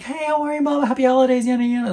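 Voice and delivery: high, sing-song greeting as a friendly sales assistant; sweet shop greeting. Retold or imitated. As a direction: gush the hello like a shop clerk. Staff greeting her at home store.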